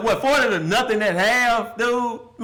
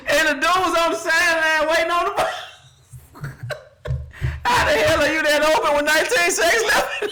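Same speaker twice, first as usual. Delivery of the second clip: laughing; 10 semitones higher than usual. Real, not acted. Laughing in disbelief at the play.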